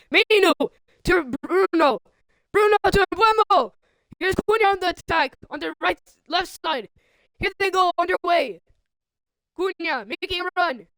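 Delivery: high, punchy football commentary on the attack; excited play-by-play. Real, not acted. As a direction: push each name out as the move builds.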